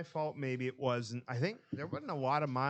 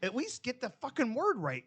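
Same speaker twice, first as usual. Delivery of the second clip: high, exasperated mock rant; berating the kid; 12 semitones higher than usual. Boss scolding the employee over the phone, mock rant. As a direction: chew him out in a high mocking voice. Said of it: "I'm like".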